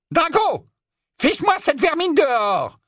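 high, imperious shout; shrill order. Acted. Bellatrix orders Drago to clear intruders, shrill. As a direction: bark the order in a piercing witch voice. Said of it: "lança Bellatrix".

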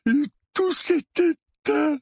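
put-on high, slow shuddering boy; hoarse, shivering. A narrator's reading. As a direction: shiver through each drawn-out word. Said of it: "d'une voix rauque, le corps parcouru de frissons". (voix rauque et frissonnante)

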